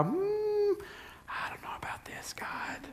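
breathy doubtful whisper; a hesitant Moses whispering to God. Acted. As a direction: whisper it, unsure and reluctant.

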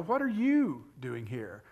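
"you" stretched out and pushed up; stress on YOU. Shown as a demonstration. Preacher repeating the question to the congregation, stress on YOU. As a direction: hit "you" hard, let "doing here" drop. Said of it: "how we put the emphasis on the question".

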